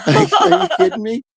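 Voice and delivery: disbelieving. Retold or imitated. Incredulous at Larvita's name-dropping.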